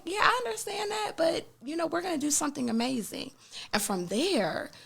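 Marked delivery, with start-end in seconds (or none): 0.0-3.3 reassuring coach. Retold or imitated. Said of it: "it's like".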